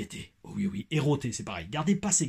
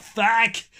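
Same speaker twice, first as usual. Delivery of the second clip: high, loud Québécois-style expletive; Québécois-accented curse; 8 semitones higher, 5 dB louder than usual. Acted. Repeating his favourite word for fun, loud Québécois accent. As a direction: punch it out in mock Québécois. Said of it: "le seul truc que j'aime bien dire".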